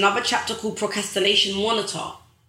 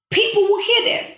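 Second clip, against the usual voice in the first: much higher and louder, rising on hear; impassioned peak; 11 semitones higher, 4 dB louder than usual. Real, not acted. Hammering home to viewers, impassioned peak. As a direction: hit the top of the build, almost calling out. Repeats point even higher.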